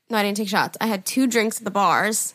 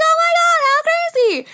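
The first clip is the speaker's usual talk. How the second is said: put-on high, loud drunken gushing; 5 dB louder than usual. Drunk girl impressed by a DJ's friend, high gushing. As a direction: squeal it like an amazed drunk. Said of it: "You know, like, drunk me".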